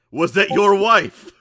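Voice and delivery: high, slow, booming question; loud incredulous question. Acted. Grant to his new friend about the pregnant woman, loudly incredulous. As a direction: shout it as a drawn-out demand.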